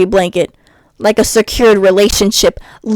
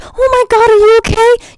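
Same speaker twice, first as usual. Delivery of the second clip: very high, gasping mock worry; hammed-up high-pitched concern; mock doting lover; 13 semitones higher than usual. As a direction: squeal it like a smitten partner. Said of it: "I'll be like".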